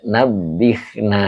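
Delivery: "nabihna" with an i in the middle syllable; correct vowel. Shown as a demonstration. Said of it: "Nah, ini yang benar ya".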